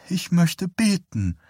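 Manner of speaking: put-on high, slow little-girl voice; childlike. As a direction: say it like a small child. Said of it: "sagte sie kindlich".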